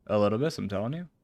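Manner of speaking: ordinary delivery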